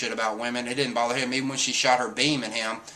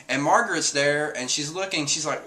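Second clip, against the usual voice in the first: ordinary talk, nothing marked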